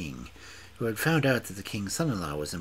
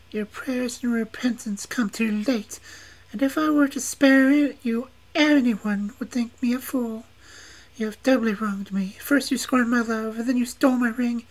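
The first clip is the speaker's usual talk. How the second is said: much higher and louder refusal; scornful witch maiden; 8 dB louder than usual. A narrator's reading. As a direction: deny him mercy, high and loud. Witch rejects his plea.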